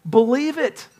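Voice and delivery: high-pitched, emphatic urging; urgent exhortation. Real, not acted. Preacher urging congregation to accept good news, urgent exhortation. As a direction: press it on them with fervor.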